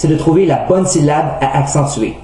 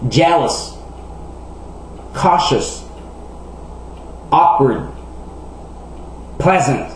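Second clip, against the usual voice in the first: "Jealous", "Cautious", "Awkward", "Pleasant", each stressed on its first syllable; 6 dB quieter, slower than usual. Shown as a demonstration. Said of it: "l'accent est normalement sur la première syllabe". (« Jealous », « Cautious », « Awkward », « Pleasant » accentués sur la première syllabe)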